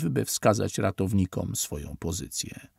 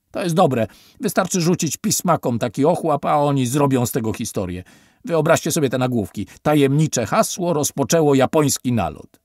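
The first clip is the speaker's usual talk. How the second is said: loud, higher, crude and scornful; gloating then ordering out; 4 semitones higher, 6 dB louder than usual. A narrator's reading. Officer gloating and throwing the survivors out, loud scorn. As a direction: relish the lie, then kick them out.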